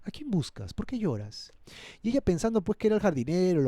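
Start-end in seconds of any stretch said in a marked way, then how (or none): 0.0-1.5 soft, gentle questioning; hushed, caring voice; tender teacher. Acted. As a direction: ask softly, as if consoling someone in tears. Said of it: "Mira qué tierno maestro".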